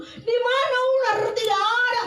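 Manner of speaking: very high, acted children's shout; high-pitched calling voice. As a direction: call back up to the tree. Friends call up to child.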